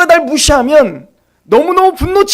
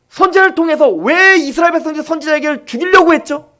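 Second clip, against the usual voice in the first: ordinary delivery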